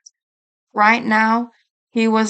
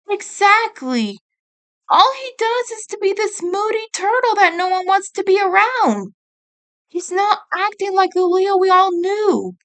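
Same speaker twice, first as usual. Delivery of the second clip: much higher, whiny younger brother; upset kid brother; 9 semitones higher than usual. Acted. Mikey complaining to Raph about Leo, high and whiny. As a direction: voice the hurt little brother.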